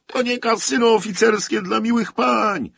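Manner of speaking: furious high-pitched shout; raging officer. A narrator's reading. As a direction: bellow it at full force, like an officer losing his temper. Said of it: "pieklił się dalej podpułkownik".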